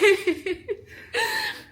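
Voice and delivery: high squealing laugh. Real, not acted. Wife cracking up at old budget talk, high squealing laugh. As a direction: let a high laugh burst out.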